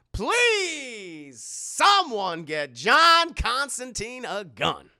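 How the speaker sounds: loud, high, drawn-out mock begging; hammed-up plea. Streamer begging chat to arm John Constantine, hammed-up plea. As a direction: stretch the words into a theatrical plea.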